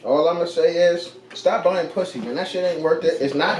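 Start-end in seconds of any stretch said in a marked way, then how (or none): none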